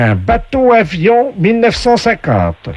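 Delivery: high, slow host announcing prizes; game show voice. Acted. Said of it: "la voix du jeu".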